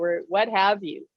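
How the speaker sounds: usual speaking voice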